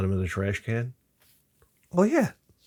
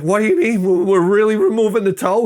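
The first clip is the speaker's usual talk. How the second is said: higher and louder, frantic; panicked disbelief; 8 semitones higher, 6 dB louder than usual. Retold or imitated. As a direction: squeal it in alarm. Panicked patient quote.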